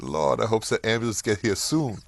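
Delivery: put-on the mother suddenly hoping the ambulance comes, light Southern accent. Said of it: "she had this lovely southern accent".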